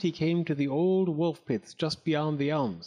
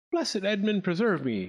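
higher, astonished oath; mildly startled appeal to the saint; exclaiming; 7 semitones higher than usual. A narrator's reading. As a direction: exclaim it in amazement. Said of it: "exclaimed Clack".

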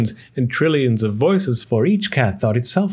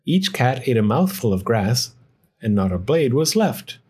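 plain reading voice

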